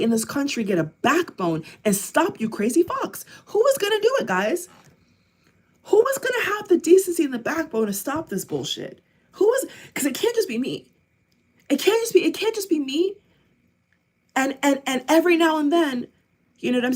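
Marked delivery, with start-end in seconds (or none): 3.5-4.7 high, urgent challenge to the listeners; exasperated appeal. Real, not acted. Asking viewers who will stop them, exasperated appeal. Pleading for someone to act. 9.3-13.2 high, insistent, frustrated pleading; exasperated plea. Real, not acted. Begging viewers not to leave it all to one person, exasperated.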